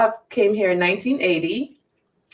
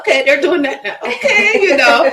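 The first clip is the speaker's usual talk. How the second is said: imitating Zumba class; 6 semitones higher, 8 dB louder than usual. Acting out Zumba class moves.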